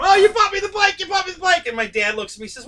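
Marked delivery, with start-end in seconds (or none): 0.0-1.6 high, loud, overjoyed shout; excited yell. Retold or imitated. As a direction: yell with pure kid joy. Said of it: "I'm yelling at him".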